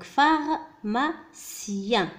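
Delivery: "pharmacien" very slowly, phar, ma, cien; syllable by syllable. Shown as a demonstration. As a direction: break "pharmacien" into separate syllables. Teacher sounds out word for learners.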